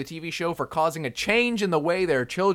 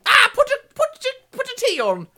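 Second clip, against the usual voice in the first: very high, hammed-up cockney screech; mock cockney; 6 dB louder than usual. Shown as a demonstration. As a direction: demonstrate a silly high cockney accent. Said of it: "Like that, you know?".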